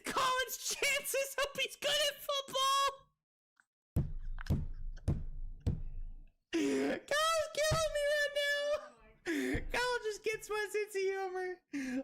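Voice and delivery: high, slow, laugh-stretched words; real giggly high-pitched delight; giddy laughing squeal. Real, not acted. Cracking up at own joke.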